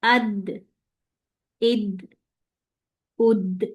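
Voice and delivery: अद इद उद with a bounce on the final द; qalqala demo. Shown as a demonstration. Quran teacher showing qalqala to students. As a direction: let the द in अद, इद, उद echo as it stops. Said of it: "साकिन हालत में देखते हैं".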